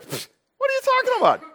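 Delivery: a pfft and a high sneer at his question; mocking disbelief. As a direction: apostle mocking Jesus, high and scoffing. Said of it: "kinda mock him".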